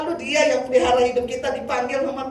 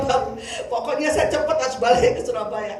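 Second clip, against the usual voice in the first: ordinary talk, nothing marked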